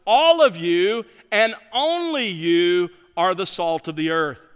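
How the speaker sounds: a preacher hammering home who is meant; emphatic. Shown as a demonstration. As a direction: lean into All of you and only you. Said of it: "It's also emphatic in Greek".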